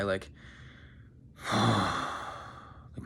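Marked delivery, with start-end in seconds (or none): 1.4-3.0 sighing. Shown as a demonstration. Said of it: "we're gonna make a sigh".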